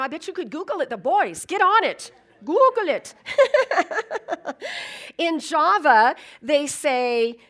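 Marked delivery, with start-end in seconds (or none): 1.5-3.1 playful order. Real, not acted. Teasing the boys. 3.2-5.1 high bubbling laugh; a giggling high laugh. Real, not acted.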